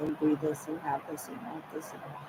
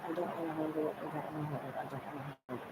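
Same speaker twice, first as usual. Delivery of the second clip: repeated plea sinking softer each time; fading plea; 10 dB quieter, much faster than usual. Retold or imitated. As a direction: trail off into a hush.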